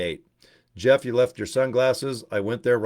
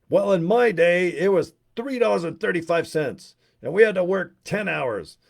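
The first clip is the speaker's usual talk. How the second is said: put-on higher, louder grumpy old man, slowed down; mock old-timer rant; 7 semitones higher, 3 dB louder than usual. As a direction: rant like a cranky grandpa at the kids. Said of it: "I start screaming at 'em".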